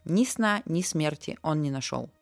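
ordinary delivery, nothing marked

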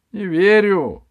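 high, loud shocked cry; disbelief. Acted. The barbarian's disbelief.

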